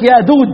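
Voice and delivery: يأدود with a d in place of each j; jim as dal. Shown as a demonstration. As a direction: swap the j of يأجوج for d, saying يأدود. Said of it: "كان اللي ينطق الجيم دال".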